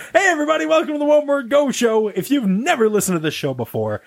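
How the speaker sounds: high, upbeat podcast intro; peppy scripted welcome, building to the last word; show-host announcer. Acted. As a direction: relaunch the show with big host energy.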